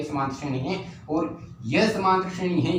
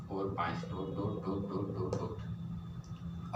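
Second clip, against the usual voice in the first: much softer, lower murmur; muttering while writing; 7 semitones lower, 13 dB quieter, much slower than usual. Real, not acted. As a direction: trail away under your breath, dot by dot. Muttering dots while writing out series.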